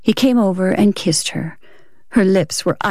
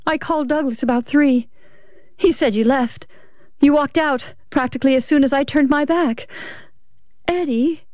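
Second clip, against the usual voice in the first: higher-pitched wife's voice; anxious wife; 6 semitones higher than usual. Acted. Wife confronting her husband who walked out, anxious and higher. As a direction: press him as a worried wife.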